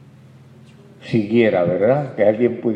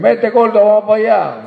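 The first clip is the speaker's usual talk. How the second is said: high, loud schoolyard jeer; loud, high jeering nickname; mocking child; 9 semitones higher, 6 dB louder than usual. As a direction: tease like a cruel kid on the playground. Kids mocking a fat boy.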